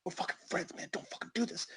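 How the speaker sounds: hushed, pleading whisper; urgent whispered plea. Acted. Homeless man begs Bobby not to tell mom, urgent whisper. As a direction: whisper it so the phone won't hear.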